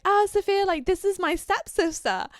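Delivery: high, loud mock introduction; date making introductions. Date introducing his supposed stepsister, high loud imitation. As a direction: imitate him announcing her too eagerly. Voices the man introducing another girl.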